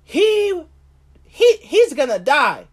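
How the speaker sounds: mock the king's fury; David's outrage. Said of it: "he basically said".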